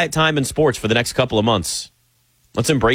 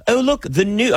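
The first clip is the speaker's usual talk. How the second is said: put-on British accent; 7 semitones higher than usual. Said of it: "I'm just gonna do a British accent".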